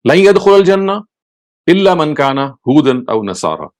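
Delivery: fast chanted Arabic verse; Quran recitation. Acted.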